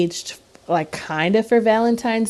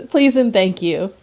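usual speaking voice

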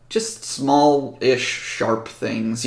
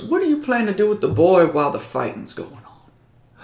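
Southern mayor; 7 semitones higher than usual. Acted. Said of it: "But he goes".

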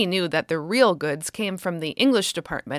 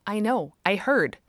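usual speaking voice

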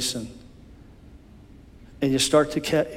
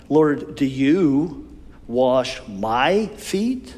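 slow, drawn-out Lord, do you wash my feet; emphatic disbelief; much slower than usual. Acted. Peter to Jesus at the foot washing, slow emphatic disbelief. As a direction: stretch each word in shocked disbelief. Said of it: "these are emphatic".

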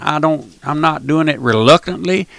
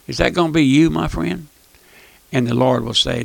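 normal talking voice

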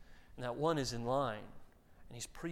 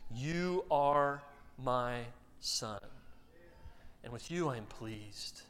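tender; 4 semitones lower, slower than usual. Acted. God's voice quoted, slow and soft.

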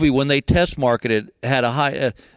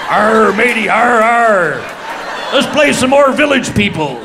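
loud, hammed-up drunk pirate with laughs; a mock pirate, louder, breaking into laughter; 4 semitones higher, 6 dB louder, slower than usual. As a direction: bellow it like a tipsy pirate on a party boat. Drunk in eye patch on boat.

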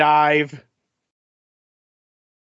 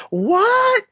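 sharp high question. Retold or imitated. Acting out cartoon scene.

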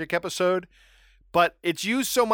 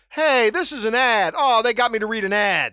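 surprised realization; 7 semitones higher, 6 dB louder than usual. Retold or imitated. Realizing the magazine cover is an ad.

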